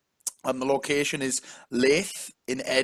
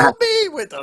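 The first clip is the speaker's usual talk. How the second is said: put-on Scottish accent, starting in a high yelp; joking Scottish accent; 9 dB louder than usual. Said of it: "I'm doing a Scottish accent".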